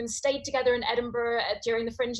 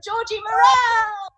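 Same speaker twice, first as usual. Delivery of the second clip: loud, high announcer call; rousing compere introduction; big introduction; 11 semitones higher, 10 dB louder, slower than usual. Real, not acted. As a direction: hype up the act's name. Compere introducing act, shouting name.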